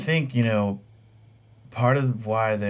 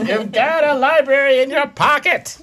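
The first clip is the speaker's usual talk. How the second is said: high, loud lecturing professor; 9 dB louder than usual. Retold or imitated. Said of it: "That's a voice of a professor that I had".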